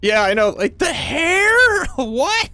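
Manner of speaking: high, loud laughing what; real shrieking laugh of disbelief. Real, not acted. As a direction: squeal with amused outrage.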